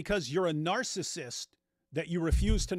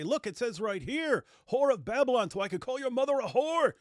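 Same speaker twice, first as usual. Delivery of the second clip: much higher and louder, a ranting preacher voice; mock a self-righteous preacher justifying insults; mocking impression of the preacher; 9 semitones higher, 5 dB louder than usual. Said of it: "And so he'll say".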